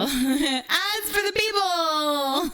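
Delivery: laughing. Real, not acted. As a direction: crack up while proclaiming it. Cracks up at own line.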